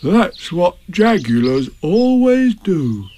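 drawn-out, fascinated explanation; interested Pooh. Acted. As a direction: drawl it with keen interest. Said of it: "much interested".

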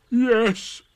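loud, high, sudden yes; emphatic interruption; cutting in. A narrator's reading. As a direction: cut them off sharply. Eeyore cuts Pooh off.

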